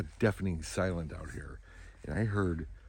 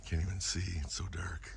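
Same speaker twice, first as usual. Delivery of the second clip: whispering; 8 dB quieter than usual. Real, not acted. Dark woods at dawn.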